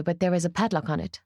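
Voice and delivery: ordinary delivery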